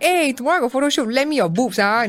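fast, high, breezy ask; cheeky pretend favour, quick and high; mock casual request. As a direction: toss it off like borrowing a pen. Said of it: "You can't, like".

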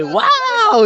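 high excited whoa. Retold or imitated. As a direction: cry out like the surfer turtle. Imitating surfer turtle from Nemo.

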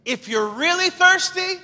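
high, loud shouted call; Jesus yelling out. Retold or imitated. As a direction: call out over a huge crowd. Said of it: "Jesus stands up and says".

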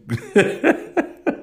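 high burst of laughter at his own joke. Real, not acted. Host laughing at his own over-the-top line, a high laugh. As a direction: crack up, high and sudden.

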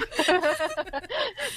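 bright high burst of laughter. Real, not acted. Laughs at the sleep comment.

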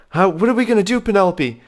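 high, desperate plea; panicked. Acted. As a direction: beg for a plan in a panic. Davis panics in crisis.

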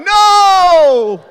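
high, loud, alarmed shout; panicked no. Retold or imitated. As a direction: shout a father's flat refusal. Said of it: "And I'm like".

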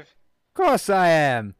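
cocky put-on British voice; swaggering British rogue; British accent. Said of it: "It'll be British. 'Cause I can do British".

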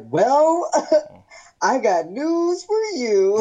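high, drawn-out delivery breaking into laughter; gleeful near reveal. Retold or imitated. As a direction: announce it teasingly, laughing.

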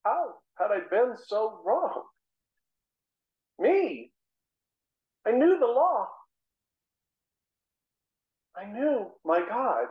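anguished outcry. Acted. Saul's anguished realization.

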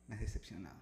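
very quiet, low verdict; imitated disappointed father. Father looking at his son, quietly disappointed. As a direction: play dad's disappointment, low and hushed.

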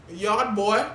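put-on haughty boss barking at the yard boy; boss calling yard boy. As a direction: call him like a snooty boss. Acting boss in Eddie Murphy scene.

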